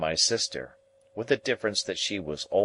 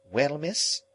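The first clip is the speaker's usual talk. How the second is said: high, rising, tentative reply; boy's high-pitched polite query; questioning boy; 9 semitones higher than usual. A narrator's reading. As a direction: answer her with a high questioning lift. Pip answers Estella, startled.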